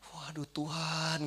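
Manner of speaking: slow, whispery groan to God; drawn-out mock-agonised whisper; tempted plea. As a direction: imitate a helpless hushed appeal. Acted plea while tempted.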